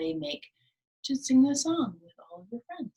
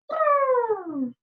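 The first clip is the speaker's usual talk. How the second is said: high loud lip-trill bray; hammed-up zebra noise; 13 semitones higher, 5 dB louder than usual. Shown as a demonstration. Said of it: "think about what sounds those animals make".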